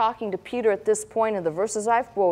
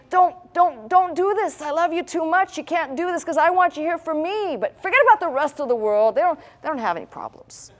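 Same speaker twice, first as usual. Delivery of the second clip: higher, urgent begging; pleading Peter; 7 semitones higher than usual. Retold or imitated. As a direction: plead with him to stay, voice raised. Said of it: "it's like Peter saying".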